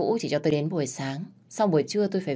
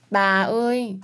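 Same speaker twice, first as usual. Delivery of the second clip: put-on child calling out; 5 semitones higher, 4 dB louder, slower than usual. Child calls out to grandma.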